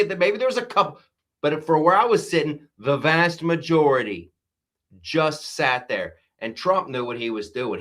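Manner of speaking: normal talking voice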